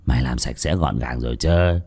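low, softened master's voice; hushed conspiring. Acted. Master checking on his servant's secret job, hushed and low. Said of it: "nói chuyện thì thầm".

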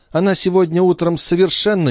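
high, exasperated complaint; peevish countess. A narrator's reading. Countess complains about Chon.

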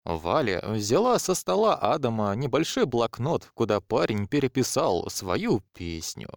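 ordinary delivery

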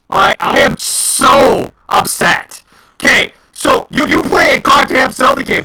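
real frustration building to a yell; exasperated gamer venting; upset rant. Real, not acted. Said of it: "I'm so pissed off".

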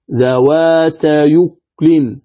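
ذوات يكل very slowly, joined as "dhawātayukulin" with no hamza; hamza transfer reading. Shown as a demonstration. Teacher showing how it reads after transfer, ذوات يكل slow and joined. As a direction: draw out ذوات يكل, gliding "yu" straight into "kulin". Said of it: "فكيف تقرأ بعد النقل".

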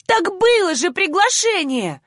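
high-pitched, drawn-out outcry; protesting cry. A narrator's reading. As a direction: cry out in protest without full volume. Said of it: "закричала ещё громче". (обиженный выкрик на высокой ноте)

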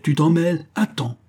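high, loud challenge; indignant threat; exclaiming. A narrator's reading. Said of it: "s'écria Friquet".

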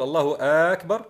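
أكبر said loudly as "aakbar", like a question; stretched hamza. Shown as a demonstration. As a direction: stretch the first vowel of أكبر and lift it. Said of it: "فمد الهمزة". (مدّ همزة أكبر كأنه استفهام: الله آكبر)

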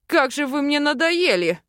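angry barked outburst; barking. A narrator's reading. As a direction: bark it out, fed up. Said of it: "рявкнул дракон".

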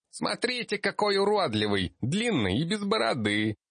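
put-on high excited kid calling out; giggly child shout. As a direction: shout to friends, half giggling. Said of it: "захихикал и крикнул остальным". (крикнул, хихикая, детским голосом)

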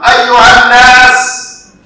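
preacher shouting the call at full force. Acted. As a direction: cry it out to a whole crowd. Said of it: "I started to raise my voice".

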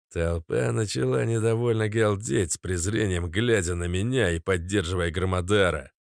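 ordinary delivery, nothing marked

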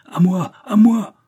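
rising yell for backup; cry for help. Acted. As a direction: call for help at the top of his lungs. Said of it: "et jeta ce cri".

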